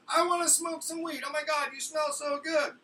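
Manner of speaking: high-pitched excited shout; shrieking with stoner enthusiasm; loud excited woman. Retold or imitated. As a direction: blurt it out at full volume, way up high. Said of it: "can you keep it down?".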